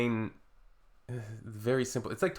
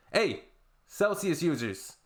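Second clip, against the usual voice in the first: ordinary talk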